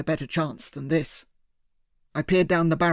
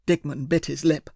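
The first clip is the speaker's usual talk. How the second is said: usual speaking voice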